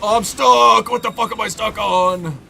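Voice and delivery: real frustrated shout at a stuck character; frustrated yell. Real, not acted. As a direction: yell at the game for getting stuck. Stuck in game, frustrated outburst.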